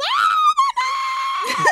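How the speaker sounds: very high, drawn-out fangirl scream; excited scream. Retold or imitated. Fans screaming as they walk up for the group picture, excited scream. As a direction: scream it loud and high, breaking into laughter. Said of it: "all of us simultaneously just screamed".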